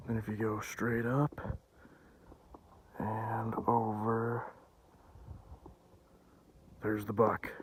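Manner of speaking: slow, hushed whisper. Real, not acted. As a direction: keep it barely audible. Whispering near the deer.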